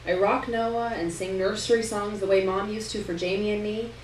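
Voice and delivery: ordinary delivery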